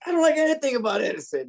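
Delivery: high-pitched mock indignation; imitated incredulous disgust. Customer answering a stupid question about Edison, imitated high indignant disbelief. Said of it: "He looked at me like".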